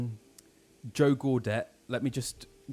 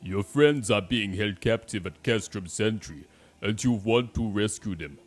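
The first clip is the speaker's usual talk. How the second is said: badly faked Austrian German accent; Arnold Schwarzenegger; 5 dB louder than usual. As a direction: play a game character with a hammy Austrian accent. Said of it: "I can't do Arnold Schwarzenegger".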